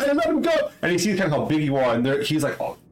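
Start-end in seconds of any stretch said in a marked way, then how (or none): 0.0-0.5 high-pitched cry to stop; urgent protest. Acted. Other kid sees his friend snatched, urgent high protest. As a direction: yelp it in protest, much higher.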